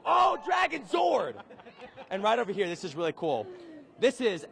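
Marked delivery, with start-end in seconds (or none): 0.1-1.3 excited. Real, not acted. Host echoes the fan's cry.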